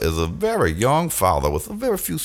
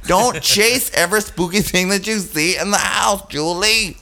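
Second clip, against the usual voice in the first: high mock scolding; 9 semitones higher than usual. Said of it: "you're like".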